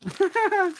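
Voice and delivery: short high chuckle; laugh. Real, not acted. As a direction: break into a quick high laugh. Laughs after reading the note.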